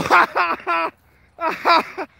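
high, loud mock laugh; fake laughing. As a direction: imitate forced, phony laughter in separate ha-has. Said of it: "fake laughing".

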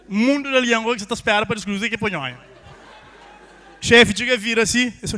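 usual speaking voice

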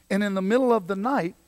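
ordinary talk

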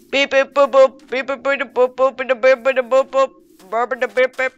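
loud monotone beeps and boops; rapid, punchy electronic-noise imitation; robot beeping. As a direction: chatter like a robot on one note. Pretending to be a Dalek.

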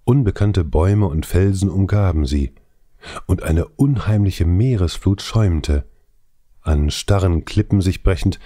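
plain reading voice

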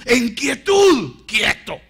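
high, very loud, drawn-out exhortation; emphatic shout. Real, not acted. Preacher urging believers to be still, emphatic shout.

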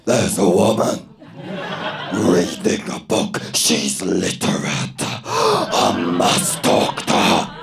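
a lightly voiced eager man on the bus.

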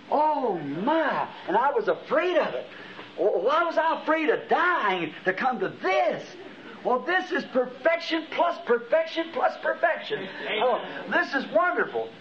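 high-pitched, drawn-out wonder; retold rapture. Preacher reliving his arrival in paradise, awestruck and high. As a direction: marvel with rising fervor, voice lifted high.

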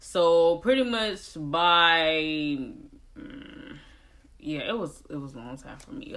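ordinary talk, nothing marked

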